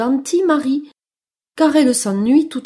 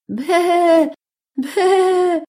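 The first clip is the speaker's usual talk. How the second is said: high imitated baa; sheep bleat; 7 semitones higher, 4 dB louder than usual. (bêlement de brebis imité)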